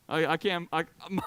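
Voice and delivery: ordinary talk, nothing marked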